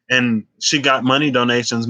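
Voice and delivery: normal talking voice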